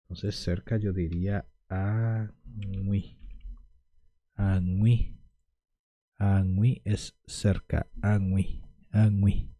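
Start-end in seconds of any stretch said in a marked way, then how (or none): 1.7-3.2 añui drawn out and careful, sounding out each part; slow careful Guaraní. Shown as a demonstration. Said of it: "Se pronuncia de esta manera". 4.3-9.5 añui with a clear nasal ñ gliding into wi; añui said crisply, again and again, for learners; Guaraní pronunciation demo. Shown as a demonstration. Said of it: "Se pronuncia de esta manera".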